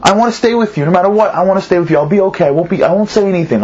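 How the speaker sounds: put-on high, insistent child's voice begging to stay; a frightened son insisting he means it; high child pleading not to be sent away; pleading little boy. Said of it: "starts to cry and says".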